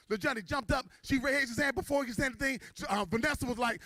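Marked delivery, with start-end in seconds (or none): none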